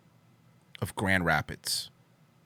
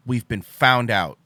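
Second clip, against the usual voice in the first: slow, louder mock-doom; mock dramatic; 7 dB louder, slower than usual. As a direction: drag it out with mock gravity.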